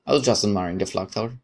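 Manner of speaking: normal talking voice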